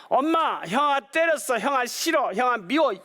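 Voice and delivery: put-on high whiny child voice; tattling little kid. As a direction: whine like a younger brother tattling. Little brother tattling to mom.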